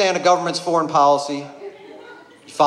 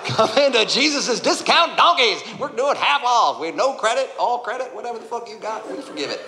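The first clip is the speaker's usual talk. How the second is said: cheesy salesman pitch; 4 semitones higher than usual. Acted. Jesus pitching discount donkeys like a hammed-up salesman. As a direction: sell those donkeys like a late-night TV ad.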